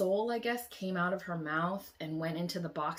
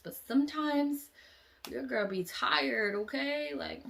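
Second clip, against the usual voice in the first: higher, slow sassy excuse; sassy aside; 8 semitones higher than usual. Real, not acted. Vlogger telling viewers she is tired, sassy. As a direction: own it with attitude. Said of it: "sassy aside to viewers".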